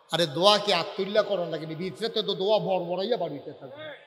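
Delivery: ordinary talk, nothing marked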